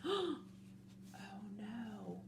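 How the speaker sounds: sharp gasp, then hushed dread; breath-only storytime horror; shocked whisper. A narrator's reading. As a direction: whisper it, aghast. Reader sees the empty cages.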